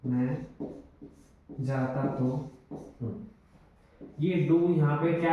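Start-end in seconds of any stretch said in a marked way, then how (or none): 0.1-3.1 मैं, pause, जाता तो हूँ drawn out slowly; slow, deliberate emphasis on तो; slow stress on तो. Shown as a demonstration. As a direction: dwell on तो, slowly. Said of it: "वो ज़्यादा सही लग रहा है".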